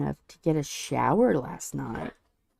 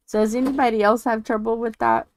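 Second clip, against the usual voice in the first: ordinary talk, nothing marked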